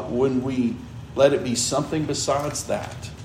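normal talking voice

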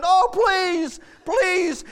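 high, drawn-out begging. Acted. Servant pleading with the king, high begging. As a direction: plead like a man about to lose everything. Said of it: "You can see somebody begging".

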